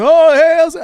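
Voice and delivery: put-on loud, high Australian-accent greeting; booming attempt at an Aussie hello; hammed-up, badly faked Australian accent. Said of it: "with his thick Australian accent".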